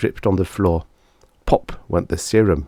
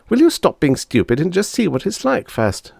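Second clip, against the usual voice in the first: put-on high exasperated boy; a little boy losing patience; boy's voice; 11 semitones higher than usual. Bob to his sister, high exasperated voice. Bob's lines.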